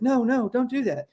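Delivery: high, frazzled mock-parent plea; frantic parent. Harried parent chasing kids round the house, high frazzled plea. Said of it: "those parents that are chasing their kids".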